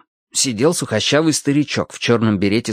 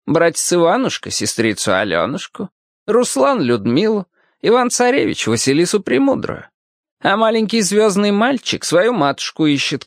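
lightly voiced old man, higher-pitched; old cobbler, instructive; 6 semitones higher than usual. A narrator's reading. Old cobbler reminds boy what real tales share, instructive. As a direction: lead the child through it like a storyteller.

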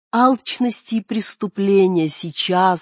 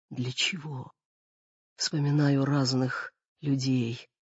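quiet, breathless and fading; out of breath; 5 semitones lower, 6 dB quieter than usual. Acted. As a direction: struggle for air between words. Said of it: "говорила мать с одышкой".

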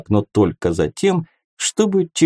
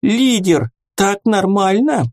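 high fairy voice; 11 semitones higher than usual. A narrator's reading. Fairy checks address.

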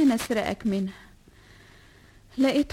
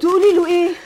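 much louder, urgent question; alarmed demand; 5 semitones higher, 10 dB louder, faster than usual. Acted. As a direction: challenge her sharply. (سؤال عالٍ منزعج)

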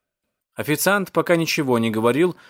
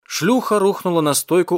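plain reading voice

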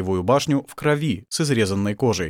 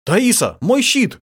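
loud, high shout rising in urgency; pressing call for help; 12 semitones higher, 6 dB louder, slower than usual. A narrator's reading. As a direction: yell urgently to your wife. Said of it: "крикнул Басиль".

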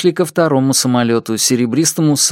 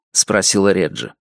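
plain reading voice

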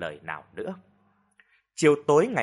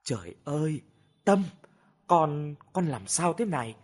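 tearful concern; 3 semitones higher than usual. A narrator's reading. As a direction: ask gently, a touch of crying. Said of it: "vừa khóc vừa hỏi han". (vừa khóc nhẹ vừa hỏi han)

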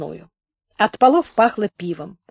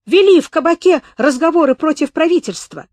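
loud accusation; 8 semitones higher, 6 dB louder than usual. A narrator's reading. Accusation then repeated.

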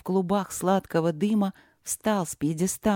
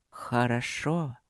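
low slow; 6 semitones lower, 4 dB quieter, slower than usual. Acted. Voice approves trial.

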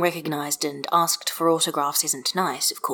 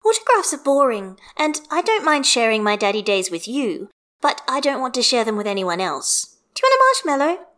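put-on higher child voice, ending in a bright offer; a cheeky kid sharing her treat; little girl explaining; 6 semitones higher, 4 dB louder than usual. A narrator's reading. Child figure Hestia.

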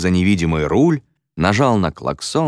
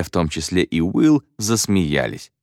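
plain reading voice

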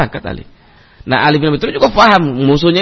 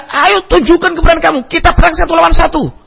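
retold battle shout; 11 semitones higher than usual. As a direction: yell the duel challenge across the field. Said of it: "Begitu dekat terus teriak".

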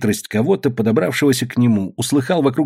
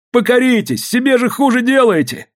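high-pitched imploring appeal; pleading; 12 semitones higher than usual. A narrator's reading. Сукнов begging the peasants to submit, high pleading. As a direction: implore them with a raised pitch. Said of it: "умолял он мужиков".

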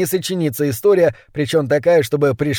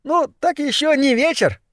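very high rat voice; rat henchman; 12 semitones higher than usual. A narrator's reading. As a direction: pipe up as a little rat. Rat henchman adds to his answer. (крысёнок пищит высоким голоском)

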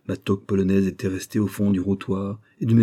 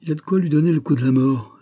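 higher, louder, lamenting; moaning mother; 7 semitones higher, 5 dB louder than usual.

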